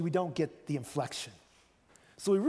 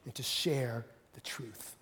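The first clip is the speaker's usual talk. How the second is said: whisper; 7 semitones lower, 7 dB quieter, slower than usual. Shown as a demonstration. Jesus speaks softly to Pilate.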